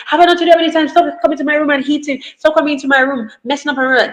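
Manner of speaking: high, rapid scolding of a sibling; half-shouted, high-pitched telling-off; mock yelling. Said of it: "instead of yelling".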